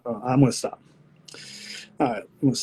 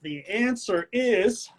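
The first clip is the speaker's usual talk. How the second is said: slow, drawn-out host tease; suspenseful reveal; 7 semitones higher, much slower than usual. Acted. Host about to give the answer, slow suspenseful tease. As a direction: stretch it out before revealing.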